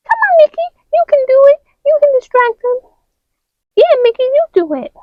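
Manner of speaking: high coaxing toy voice; high cheering doll voice; high character voice. Acted. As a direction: egg Mickey on, high. Figures urge Mickey.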